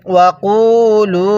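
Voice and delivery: drawn out ওয়াকুলু chant; slow recitation. Shown as a demonstration. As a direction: stretch ওয়াকুলু slowly. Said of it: "হ্যাঁ, কিভাবে পড়ব?".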